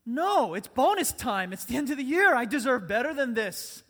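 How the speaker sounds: loud, high mock outrage; hammed-up entitled complaint; indignant protest. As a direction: demand your due at full volume. Acts an entitled worker's protest.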